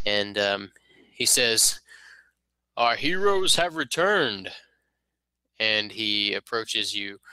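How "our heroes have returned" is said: high, slow stately lord voice; a noble welcoming heroes home, grandly; lord's greeting. Acted. Lord welcoming returning heroes, stately and high.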